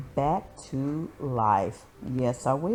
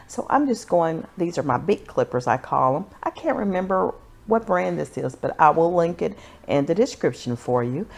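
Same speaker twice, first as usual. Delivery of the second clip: ordinary delivery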